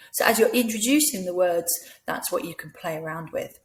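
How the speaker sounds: usual speaking voice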